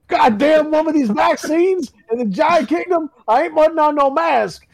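put-on Southern accent. Southern giant ranting to spouse about vaccines, mock Southern accent. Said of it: "this giant has a Southern accent".

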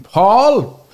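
high, loud scolding yell; sharp shout of a name. Retold or imitated. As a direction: bark the name like a cross mum. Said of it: "she'll say".

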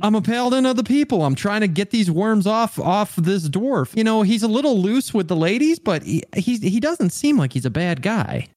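high, hammed-up earnest paladin defending himself; indignant paladin. Paladin protests to his mocking friend, high and hammed-up. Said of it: "paladin answers the mockery in character".

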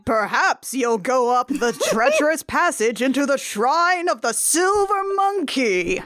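theatrical game-show style proclamation; mock ominous announcer. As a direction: proclaim it grandly, stretching the last words.

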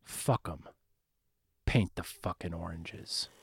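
low, soft murmur of a muse; hushed whisper in ear. A narrator's reading. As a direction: lean in and say it low, close to the ear. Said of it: "whispered in his ear".

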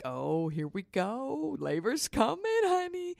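singsong glee, sliding up to a squeal; gleeful anticipation. Retold or imitated. Happy mother timing baby kicks, gleeful anticipation. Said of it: "in the happiest moment of my life".

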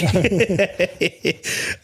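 a burst of real laughter. Real, not acted. Laughing at the film scene.

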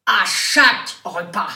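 À chaque repas, chaque hit loud and slow; slow, loud, punch chaque; emphatic reading of the card, chaque stressed. Shown as a demonstration. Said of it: "Il y a écrit chaque en majuscule".

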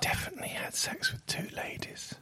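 hushed whisper; ASMR whisper. Pretend. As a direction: whisper softly into the mic. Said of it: "A S M R voice".

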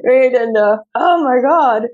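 usual speaking voice

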